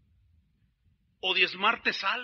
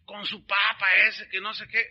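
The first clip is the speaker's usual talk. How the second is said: mock criticism; 5 dB louder, slower than usual. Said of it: "nosotros criticamos a los católicos".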